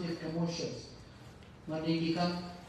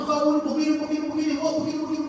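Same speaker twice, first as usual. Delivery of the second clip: much higher, louder ranting scold; angry scolding; 9 semitones higher, 7 dB louder, much faster than usual. Retold or imitated. Young man berating Imam Hasan in anger, ranting. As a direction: rail at the Imam, hot-headed.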